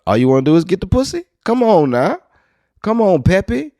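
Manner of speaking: loud, high-pitched mock scolding; mock outrage. Scolding Pepe Le Pew for his behavior, mock outrage. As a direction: plead loudly in high, exasperated disbelief.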